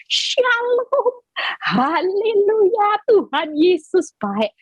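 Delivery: mock sweet piety. Preacher mocking hypocrites fawning over rich people, sugary fake piety. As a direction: mimic a fake believer gushing at the rich. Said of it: "Kalau ketemu orang kaya".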